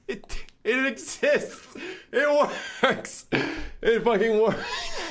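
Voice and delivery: high, slow, laughing disbelief; giddy relief. Real, not acted. Feature finally works.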